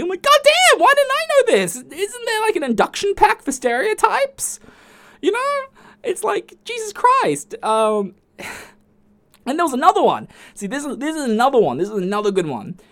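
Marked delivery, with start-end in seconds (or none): 0.2-7.5 high, loud outraged whine; squealing incredulous rant; exasperated disbelief. Retold or imitated.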